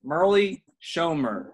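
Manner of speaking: formal podium announcer read; draft announcer. Acted. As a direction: announce it like a league commissioner. Announces second pick.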